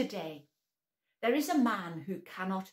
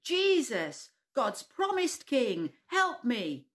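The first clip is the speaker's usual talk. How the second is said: slow, higher, louder desperate shout; 4 semitones higher, 5 dB louder, slower than usual. Acted. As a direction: yell it even louder. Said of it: "shouted even louder".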